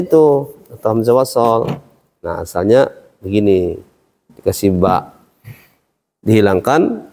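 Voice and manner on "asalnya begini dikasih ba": ordinary talk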